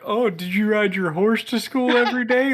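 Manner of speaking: high, singsong mock curiosity; imitated out-of-stater. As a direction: play a naive outsider asking. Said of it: "talk to anybody out of state".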